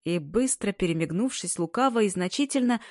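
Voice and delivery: usual speaking voice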